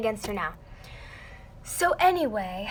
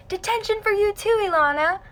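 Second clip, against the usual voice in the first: high, louder imitated telling-off; mild mock scream, pitched up; mock screaming teacher; 10 semitones higher, 4 dB louder than usual. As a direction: snap out a punishment as the teacher. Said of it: "she's screaming at me".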